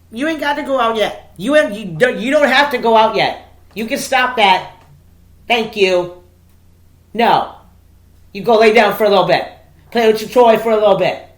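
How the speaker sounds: loud, high firm dog commands; commanding the dog. Real, not acted. Telling the dog to lie down now, firm loud commands. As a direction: order the dog to settle down.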